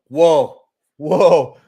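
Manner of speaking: loud, startled double whoa; jolted by the chart on screen; surprised whoa. Real, not acted. Sees the Rivian chart.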